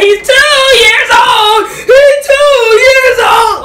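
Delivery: slow, high, loud jeering chant; taunting shout. Retold or imitated. Ray yells to the party that the birthday boy is two, slow and high. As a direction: stretch it out, yelling the taunt. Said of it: "He was like".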